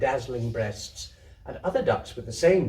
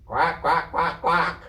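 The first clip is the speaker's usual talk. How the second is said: duck quacks; 3 semitones higher, 4 dB louder, slower than usual. Acted. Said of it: "answered the ducks".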